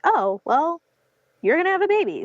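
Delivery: high, chirpy mock announcement; imitating surprised nurse. Nurses telling mother the baby is coming, bright surprised mock voice.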